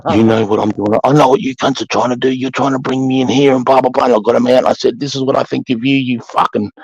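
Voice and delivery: high-pitched mock tirade; telling off recruits. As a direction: rant at them like an angry sergeant. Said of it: "I just said".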